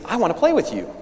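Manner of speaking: the little prince pleading to play, bright and high; eager little prince. Acted. Little prince asking the fox to play, eager and high.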